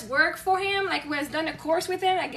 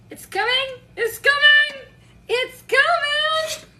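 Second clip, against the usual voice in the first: rising, louder call with the last one drawn out; excited anticipation; 9 semitones higher, 5 dB louder, much slower than usual. Acted. Speaker telling herself a role is on its way, excited. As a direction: herald it with mounting excitement.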